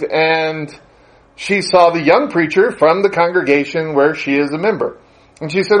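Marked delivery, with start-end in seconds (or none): none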